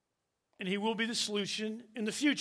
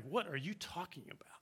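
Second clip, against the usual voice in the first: whispered thought; 2 semitones lower, 11 dB quieter than usual. Retold or imitated. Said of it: "they're thinking at this point, like".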